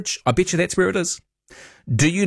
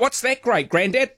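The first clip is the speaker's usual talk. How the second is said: put-on pitched-up little kid voice; child asking; 10 semitones higher than usual. Grandchild asking Grandad about the grape, high kid voice. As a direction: ask like a curious grandchild.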